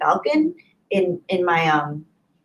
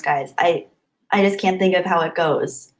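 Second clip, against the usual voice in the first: usual speaking voice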